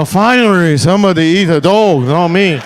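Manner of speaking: gleeful mock Chinese accent voicing a crowd. Comic voicing Chinese people, gleeful in mock Chinese accent. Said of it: "Chinese people are like".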